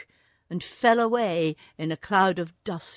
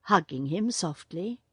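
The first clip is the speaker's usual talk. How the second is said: ordinary delivery, nothing marked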